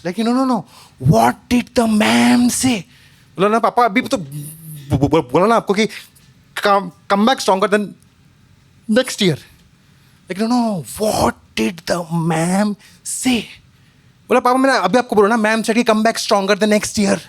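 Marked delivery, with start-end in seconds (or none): 0.2-2.8 slow insisting dad. Retold or imitated. 3.6-9.4 stammering. Retold or imitated. Stammering boy repeats. 10.4-13.4 slow insisting dad. Retold or imitated. 14.4-17.2 exasperated. Retold or imitated. Exasperated boy repeating.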